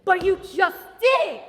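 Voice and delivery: loud, slow outraged protest; indignant shout. Acted. Child shouting back at the nitpicking teacher. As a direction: yell it back at her.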